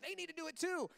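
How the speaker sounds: high, petulant mock objection; defensive protest. Bickering spouse blaming the other, defensive. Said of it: "You're probably like".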